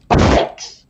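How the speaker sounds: sudden harsh shout; explosive one-word yell, imitated; prankster's yell. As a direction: startle a relaxed shopper with a bellow. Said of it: "and he goes".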